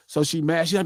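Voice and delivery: normal talking voice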